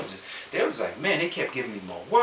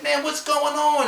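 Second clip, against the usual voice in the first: demanding confrontation; 13 semitones higher, 4 dB louder, slower than usual. Retold or imitated. Storming into CFO's office.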